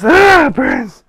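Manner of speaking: loud, high, drawn-out yell; slow shout of muscle pain; pained cry. Real, not acted. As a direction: cry out from the burn of exercise. Crying out at exercise burn.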